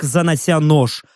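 ordinary delivery

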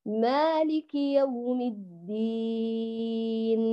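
slow chanted recitation, steady lengthening; slow tajwid chant of the opening verse; Quran recitation. Shown as a demonstration. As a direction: recite slowly with even madd. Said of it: "خلي المقدار ثابت".

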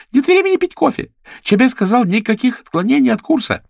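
high, loud shriek; shouting. Acted. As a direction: yell it, high and shrill. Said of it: "Гладис закричала". (громкий высокий крик)

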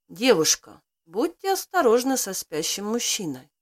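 usual speaking voice

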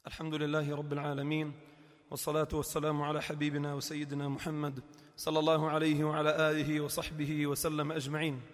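quiet formal praise; soft formal khutba opening. Acted. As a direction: recite the opening gently. Second khutba formula.

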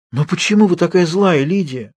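high, imploring question; pleading. A narrator's reading. Girl asking her harsh roommate why, imploring. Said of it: "В голосе её почувствовалась мольба".

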